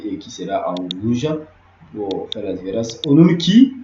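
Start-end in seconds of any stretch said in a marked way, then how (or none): 3.1-3.7 Onunki said high and loud, rising at the end; teacher models Onunki brightly and loudly; model pronunciation. Shown as a demonstration. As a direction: sing out Onunki for the class to repeat. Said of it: "ça veut dire quoi?".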